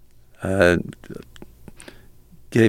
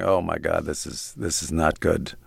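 low, sinking dread under the breath; soft dismayed muttering; 5 semitones lower, 6 dB quieter than usual. Retold or imitated. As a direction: quietly groan it to yourself, much softer. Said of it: "I'm going".

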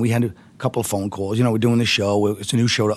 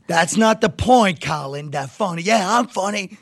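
mock scolding rant; 8 semitones higher, slower than usual. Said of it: "He starts screaming at me".

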